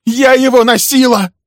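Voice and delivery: very high, loud scream; embittered, full-voiced outburst; bitter cry. A narrator's reading. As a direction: shriek it with bitter pride. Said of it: "горько вскричала Милли".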